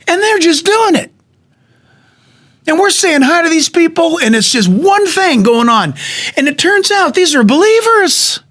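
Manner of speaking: high, ringing wonder; amazed. Real, not acted. Preacher marvelling to the congregation, high and amazed. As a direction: let the voice soar in amazement.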